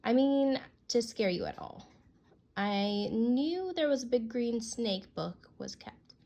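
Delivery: ordinary delivery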